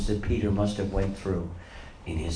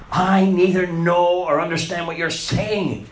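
raised, insistent denial; 6 semitones higher, 4 dB louder than usual. Acted. Peter denying to the servant girl, raised and insistent. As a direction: push the accusation away hard. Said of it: "But he denied it".

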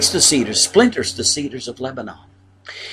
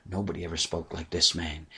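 much softer, lower, awestruck; awed hush; 7 semitones lower, 13 dB quieter than usual. Retold or imitated. Soldiers answer the Pharisees in an awed hush. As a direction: say it quietly as if still stunned.

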